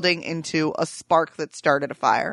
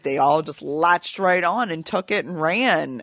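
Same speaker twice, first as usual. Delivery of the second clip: ordinary talk, nothing marked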